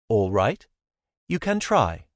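a hint of a teasing foreign woman; Zara, playful. A narrator's reading. Zara daring him to guess, lightly voiced lilt. Zara's voice in dialogue.

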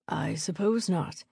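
slow, soft, measured baron's reply; grudging baron. A narrator's reading. Baron giving in to a persuasive visitor, grudging. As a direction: concede it slowly, reluctantly.

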